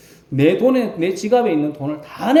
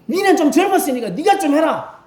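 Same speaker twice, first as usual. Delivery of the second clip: higher, offhand dialect command; dismissive order; 10 semitones higher than usual. Retold or imitated. Tired elder telling a younger member to do it, offhand. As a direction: brush the work off onto the younger one. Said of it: "이 말 아니겠습니까".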